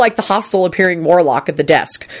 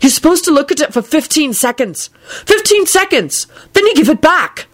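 high, mounting exasperation; barked protest; 10 semitones higher than usual. A narrator's reading. Neville arguing with the warlock over his wand, barking. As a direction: argue it hotly, climbing higher on the repeat. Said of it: "Neville barked at the warlock".

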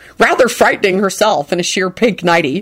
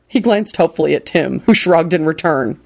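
ordinary delivery

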